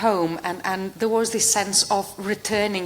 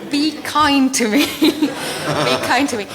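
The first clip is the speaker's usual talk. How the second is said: laughing plea; 6 semitones higher, 6 dB louder, slower than usual. Real, not acted. Jokey plea before closing talk.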